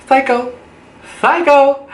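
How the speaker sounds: very high, rising squeal of psycho; mock horrified accusation. After her date says he comes here all the time, a high horrified squeal. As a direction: shriek the word like a horror-movie victim.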